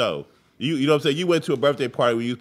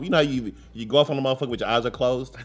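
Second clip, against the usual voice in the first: ordinary talk, nothing marked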